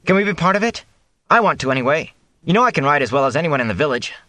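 put-on high, keen young man's plea; eager pleading. A narrator's reading. Matt begs to join the patrols, eager and high. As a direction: pitch it up and rush the last boast.

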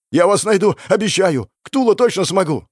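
high, loud desperate vow; frantic promise. Acted. Frantic promise before disappearing.

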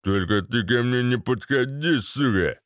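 put-on lout voice, grudging; grumbled thug warning; grumbling thug. A narrator's reading. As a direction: warn him off like a surly thug. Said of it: "проворчал Вован".

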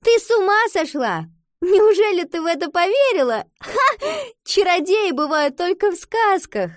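high, loud, mocking disbelief; hammed-up incredulous ridicule; exaggerated laughing shout. A narrator's reading. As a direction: cry out with a forced scoffing laugh. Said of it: "нарочито рассмеявшись, крикнула".

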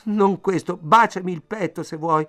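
high, loud, whimpering plea; imploring, terrified. A narrator's reading. Woman pleading with the man in the dark, terrified. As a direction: beg desperately in rising panic. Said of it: "Le sue parole erano così imploranti".

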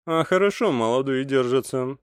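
approving comment. A narrator's reading. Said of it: "прокомментировал".

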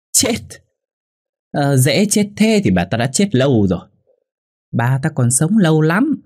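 high-pitched scornful retort after a scoffing laugh; mocking, threatening wife; sneering. A narrator's reading. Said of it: "Hòa nhếch mép đáp".

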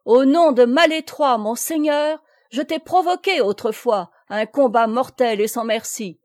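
loud, high proclamation; resounding challenge. A narrator's reading. Noël challenging his enemy in his lord's name, resounding challenge. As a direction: declaim it as a formal challenge to combat. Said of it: "dit-il d'une voix retentissante".

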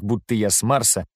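ordinary delivery, nothing marked

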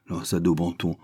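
ordinary delivery, nothing marked

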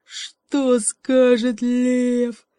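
drawn-out, loud worried bear; the bear fears the lion's anger; slow loud bear. Acted. Bear dreading the lion. (медленно и громко, с тревогой)

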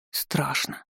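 hushed, scared whisper; frightened confession under the breath; timid whisper. A narrator's reading. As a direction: whisper it fearfully, very soft. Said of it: "осторожно выглядывая из-за меня".

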